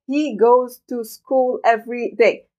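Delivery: very slow, every word equal and separate; robotic. Shown as a demonstration. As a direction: read it like a machine, no stress. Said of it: "ما حتسمعون أبدًا عم يلفظوها بهذه الطريقة". (بطريقة آلية بطيئة جدًا)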